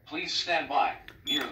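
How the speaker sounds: ordinary talk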